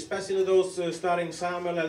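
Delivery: ordinary talk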